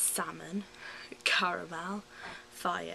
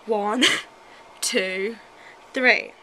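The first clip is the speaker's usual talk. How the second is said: loud countdown; 14 dB louder, much slower than usual. Acted. Tigger counts down the kite launch.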